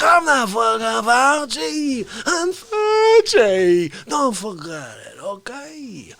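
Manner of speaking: high, slow, hammed-up boasting voice; exasperated blowhard character. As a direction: drag out the big words, building to yells.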